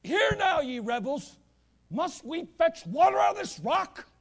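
high, loud rebuke; angry Moses. Retold or imitated. Moses rebukes the people at the rock, loud and angry. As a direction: thunder at the rebels in anger. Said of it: "And he says".

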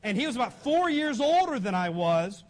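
normal talking voice

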